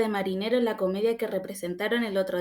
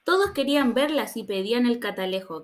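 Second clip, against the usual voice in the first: ordinary delivery, nothing marked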